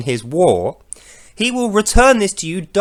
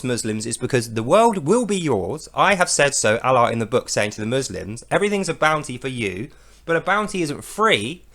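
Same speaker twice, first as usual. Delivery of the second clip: normal talking voice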